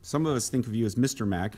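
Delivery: ordinary talk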